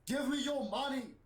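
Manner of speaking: high-pitched, put-on demand; mock yell. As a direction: act out a politician shaking someone down.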